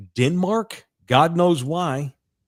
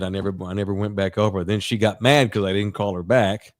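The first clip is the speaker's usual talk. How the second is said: usual speaking voice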